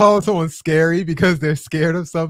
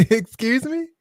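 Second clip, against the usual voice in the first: laughing disbelief; 9 semitones higher than usual. Real, not acted. Repeats shocked question.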